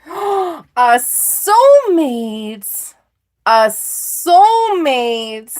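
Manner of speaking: loud, very drawn-out exclamation; hammed-up big reveal; thrilled announcement. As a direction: belt it out slowly, savoring each syllable. Gasps at the soulmate card.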